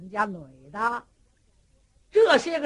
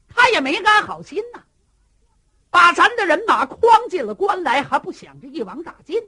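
loud, high indignant outburst; furious raised voice; angry accusation; 7 semitones higher, 12 dB louder than usual. Acted. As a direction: rail against her treachery. Wang Yan angrily accuses the bride.

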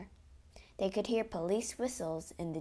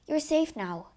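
high, eager reassurance; reassuring Count; 7 semitones higher, 4 dB louder than usual. A narrator's reading. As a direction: reassure her after the rescue. Count reassures rescued Christine.

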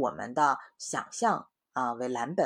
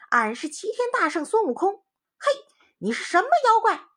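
much higher, louder, proud and indignant; indignant Monkey King; 9 dB louder than usual. Acted. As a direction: challenge the impostor, high and loud. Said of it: "那个猴头猪身说". (孙悟空高声质问妖怪)

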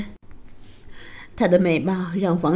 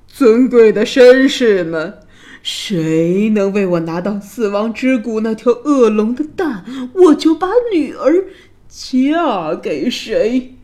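loud proclamation; 2 semitones higher, 6 dB louder than usual. A narrator's reading. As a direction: announce a challenge in a big royal voice. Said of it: "大声叫道".

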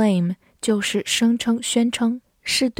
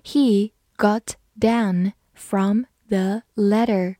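careful model sentence, each word set apart; slow reading; slower than usual. Shown as a demonstration. Teacher reading along with learners, slow reading. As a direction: read slowly, word by word. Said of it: "慢慢来读".

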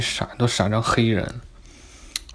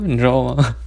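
normal talking voice

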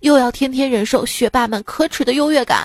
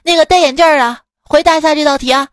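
loud, fast bellow; roaring teacher; 3 semitones higher, 5 dB louder, faster than usual. Acted. Teacher calling out the boy with glasses, yelling. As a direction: yell across the classroom. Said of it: "吼道".

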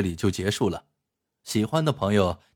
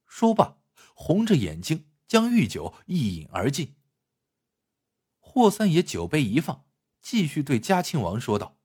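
higher, animated storyteller's voice; story narration; 5 semitones higher than usual. A narrator's reading. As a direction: narrate the tale with lively pitch swings. (评书式故事旁白)

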